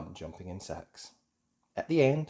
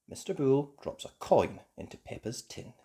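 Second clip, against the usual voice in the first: plain reading voice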